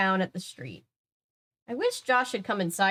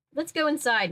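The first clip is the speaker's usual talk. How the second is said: urging; 7 semitones higher than usual. A narrator's reading. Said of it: "Mister Dawes urged".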